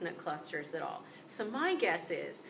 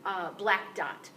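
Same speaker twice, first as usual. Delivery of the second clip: ordinary delivery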